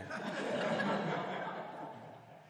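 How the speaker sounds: breathy chuckle; airy chuckle. Real, not acted. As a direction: chuckle at the punchline coming. Laughing at own joke.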